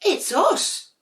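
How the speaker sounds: very high, sing-song little voices; sweet sibling penguins. Acted. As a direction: coo it like cuddly little penguins. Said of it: "said two of Dudley's sisters".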